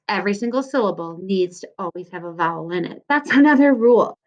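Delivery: usual speaking voice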